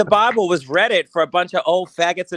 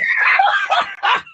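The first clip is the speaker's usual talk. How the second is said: high shrieking burst of laughter; 4 dB louder than usual. Real, not acted. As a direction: let out a loud squealing laugh.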